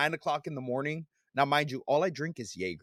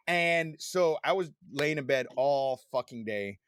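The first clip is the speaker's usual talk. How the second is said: ordinary talk, nothing marked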